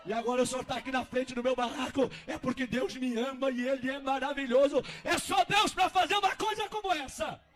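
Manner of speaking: testemunho em voz alta, subindo ao brado agudo; cresça até um clamor de louvor; louvor exaltado. Acted. Homem glorifica a Deus, emocionado. (glorificando aos brados)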